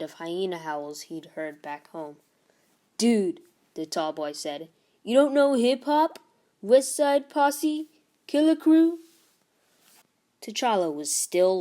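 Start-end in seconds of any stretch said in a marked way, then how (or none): 3.0-3.4 loud scoffing "Dude"; cocky bully. Acted. Tall boy mocks T'Challa. 5.0-9.0 put-on higher, louder incredulous teen voice; mock the kid who's never heard hip-hop; bully sneering at the new kid, high and loud; cocky bully. Tall boy mocks T'Challa.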